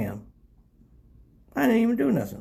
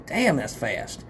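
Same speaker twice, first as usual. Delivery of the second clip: ordinary talk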